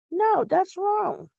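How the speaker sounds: high, slow, ringing exclamation; outraged correction; emphatic no. Real, not acted. Scolding guests who don't greet.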